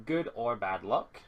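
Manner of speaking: normal talking voice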